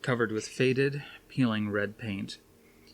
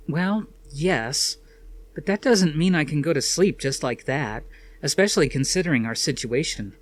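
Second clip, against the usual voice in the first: higher, louder anxious woman's voice; Suzanne's voice; 5 semitones higher, 5 dB louder than usual. A narrator's reading. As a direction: voice Suzanne, uneasy and quick.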